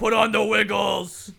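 loud laughing shout; loud drunk heckling. Pretend. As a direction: yell it from the crowd.

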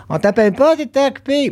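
very high put-on voice; high-pitched mock caller; mimicked excuse. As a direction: squeak it out like a feeble excuse. Mimics people who stopped calling him.

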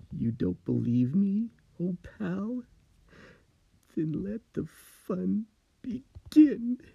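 creepy slow taunt; sinister threat. Acted. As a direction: let the madness out with glee. Steve turns sinister.

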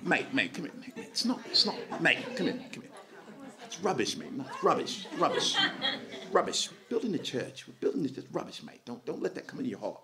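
put-on soft, laughing British mate accent; mocking British bloke, hushed, with laughs. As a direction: do a cheeky Brit waving it off. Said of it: "if it's in English".

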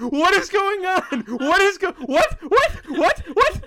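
high, loud repeated "what?"; astonished shouting. Real, not acted. Watching the violent scene suddenly start, shouting in astonishment. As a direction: shout in real disbelief, laughing.

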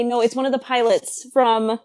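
ordinary talk, nothing marked

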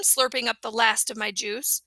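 usual speaking voice

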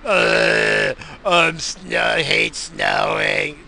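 loud, groaning mock complaint; sulking son impression.

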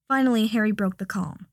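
usual speaking voice

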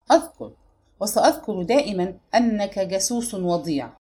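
ordinary delivery

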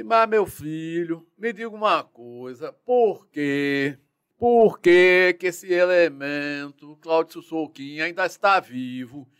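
put-on slow, emphatic Northeastern Brazilian accent; the general demanding an answer, slow and weighty. General asking why the suspect lives, Northeastern accent. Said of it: "Aquele sotaque de nordestino".

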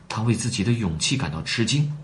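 plain reading voice

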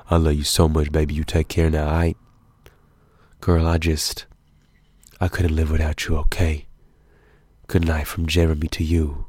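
low crooning lover, lightly voiced; R&B slow jam outro. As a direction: purr it like an R&B singer. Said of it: "Like at the end of the R and B songs when they're talking".

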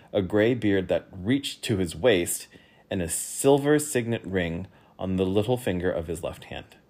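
usual speaking voice

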